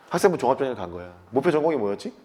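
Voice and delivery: ordinary talk, nothing marked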